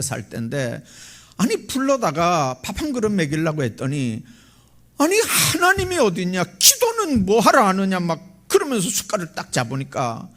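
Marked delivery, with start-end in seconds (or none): 5.0-8.0 high-pitched indignant mock protest; scoffing retort. Beggar refusing the pastor's table prayer, scoffing retort. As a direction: scoff at the very idea of praying.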